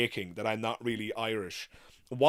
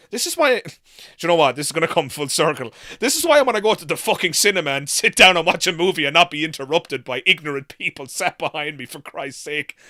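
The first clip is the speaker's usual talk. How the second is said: loud, fed-up outburst about noisy moviegoers; exasperated rant; 5 semitones higher, 10 dB louder than usual. Real, not acted. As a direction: vent it, properly fed up. Ranting about people at cinema.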